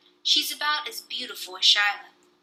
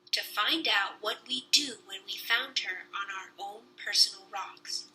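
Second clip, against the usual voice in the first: plain reading voice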